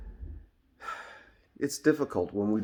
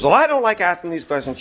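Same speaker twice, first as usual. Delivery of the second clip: mildly upset retort, pitched higher; upset clerk; 8 semitones higher, 5 dB louder than usual. Retold or imitated. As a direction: imitate the clerk getting a bit upset. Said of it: "She's getting upset".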